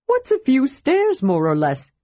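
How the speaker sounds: breezy, carefree question pitched high; lightly. A narrator's reading. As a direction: toss it off lightly. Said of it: "Tass asked lightly".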